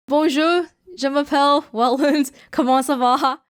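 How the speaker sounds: put-on English-accented French greeting; shy beginner French with English accent; foreign accent in French. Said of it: "can you try to speak French a little".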